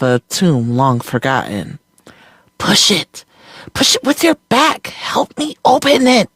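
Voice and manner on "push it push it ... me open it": put-on high, urgent girl's cry; urgent girl exclaiming. A narrator's reading. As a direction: push her to exclaim urgently. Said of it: "the girl exclaimed".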